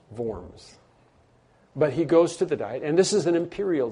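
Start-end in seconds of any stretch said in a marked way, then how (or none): 0.1-0.8 Worms said softly as "Vorms"; German pronunciation. Shown as a demonstration. Pastor correcting the city's name to Vorms. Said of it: "not worms".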